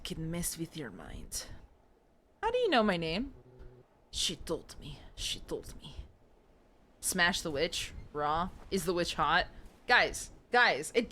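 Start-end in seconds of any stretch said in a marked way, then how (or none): 5.1-6.0 eerie unvoiced whisper of the same line. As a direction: whisper it like a secret from the witch. Creepy repeated line.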